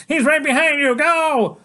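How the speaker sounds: very high, loud shout; yelling. Acted. Freak yelling at the boy to flee, very high and loud. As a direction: scream at him to run. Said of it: "Freak is yelling".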